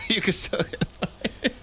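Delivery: high, slow echo through laughter; laughing. Real, not acted. Co-host echoing a worried teacher, laughing. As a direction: repeat it softly while cracking up.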